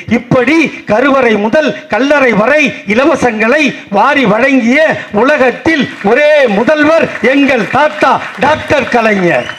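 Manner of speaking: slow, high, loud drawn-out proclamation; oratorical climax. Acted. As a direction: stretch out each phrase to crown the leader. Climax of the oration.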